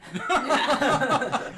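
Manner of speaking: loud, high laugh; real high-pitched guffaw; burst of laughter. Real, not acted. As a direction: crack up suddenly and loudly. Laughs at player's request.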